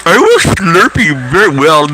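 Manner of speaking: high-pitched cartoon Indian accent; Apu. Imitating the doctor who comes in, mock Indian accent. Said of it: "He sounds like Apu from The Simpsons".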